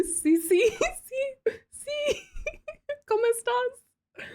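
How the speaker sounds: high, squeaky giggle through the words; helpless high-pitched laughing. Real, not acted. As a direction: crack up mid-word, pitch way up. Cracks up attempting Spanish.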